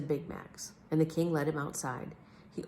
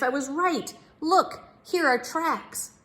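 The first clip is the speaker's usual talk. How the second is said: much higher, slow eager pointing out; Big Max voice; 11 semitones higher, 5 dB louder, slower than usual. A narrator's reading. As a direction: show off the find, high and drawn out. Big Max shows the tracks.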